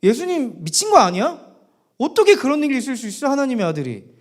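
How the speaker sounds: high, incredulous questioning; can't accept it, acted outburst; stretched first question, then rushed dismay; Peter's disbelief. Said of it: "베드로는 지금 미치겠는 거예요".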